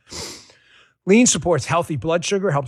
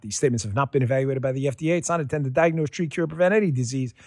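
fast disclaimer; faster than usual. Acted. Legal disclaimer read.

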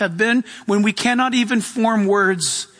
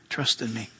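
much softer, almost breathed; near whisper; 12 semitones lower, 10 dB quieter than usual. Retold or imitated. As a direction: let it fade to a hush. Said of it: "that still, small voice".

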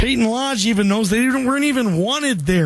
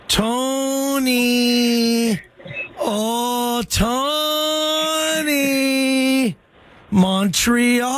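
calling out; much slower than usual. Real, not acted. Host calls a sleeping caller on the line, drawn-out call. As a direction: wake him up by calling his name.

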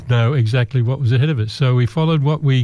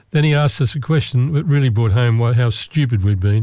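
ordinary delivery

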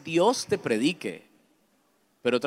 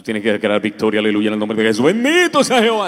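mock triumphant preacher; 4 semitones lower, 10 dB louder, faster than usual. Mocking people who always declare victory, loud preacher. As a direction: ham up the victory-declaring believer. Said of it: "así la gente".

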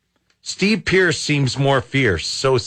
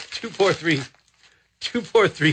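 ordinary talk, nothing marked

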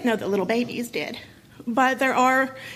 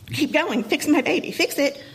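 pleading, pitched up high, a mild retold desperation; begging; 5 semitones higher than usual. Mother begging ER doctors to save her baby, mild high plea. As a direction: beg the doctors to keep going. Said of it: "begging for them".

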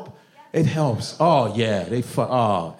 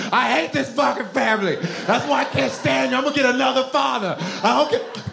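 retold child scream; 9 semitones higher than usual. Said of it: "I would scream".

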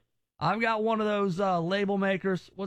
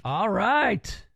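usual speaking voice

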